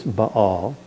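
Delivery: "Baal" in two syllables, ba-AL; vowels pronounced separately. Shown as a demonstration. Teacher restating the Hebrew way, "Baal" as ba-AL. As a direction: give each a in "Baal" its own beat. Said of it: "you pronounce each vowel separately".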